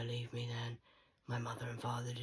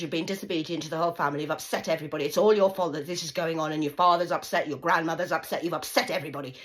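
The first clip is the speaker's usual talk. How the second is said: fast, much louder, higher furious tirade; mother berating her daughter at Christmas, furious and loud; mum yelling; 5 semitones higher, 16 dB louder, faster than usual. Retold or imitated. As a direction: scold her like she ruined the family. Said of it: "She says".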